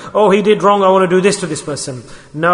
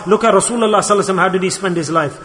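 ordinary talk, nothing marked

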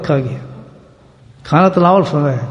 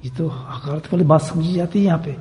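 much softer, confidential murmur of reproach; speaking softly; 10 dB quieter than usual. Retold or imitated. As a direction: discreetly caution him in an undertone. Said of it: "اس نے تو آستہ کہا".

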